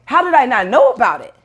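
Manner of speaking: high, heated disbelief, retold; a young self's mock fury at the elites; mock angry outburst. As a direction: fire it off like outraged at learning the truth. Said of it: "Then you got angry".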